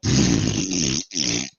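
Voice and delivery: long low lip raspberry; imitated fart noise. Storyteller demonstrating his bowels emptying, sputtering raspberry.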